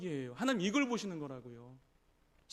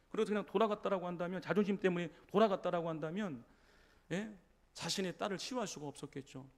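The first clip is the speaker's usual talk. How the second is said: ordinary delivery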